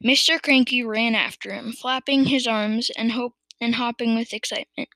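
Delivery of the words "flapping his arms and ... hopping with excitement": ordinary delivery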